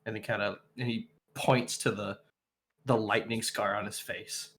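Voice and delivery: normal talking voice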